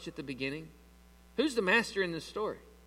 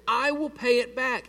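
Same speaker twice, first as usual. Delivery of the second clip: slow, raised, emphatic plea; begging; 5 semitones higher, 5 dB louder than usual. A narrator's reading. Fellow servant promising repayment, begging. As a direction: promise it pleadingly, drawn out. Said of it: "He begged him".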